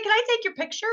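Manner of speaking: high, eager ask; eager request. Retold or imitated. Artist asking Cindy for a picture, high and eager. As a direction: stranger hoping for a photo, high and eager.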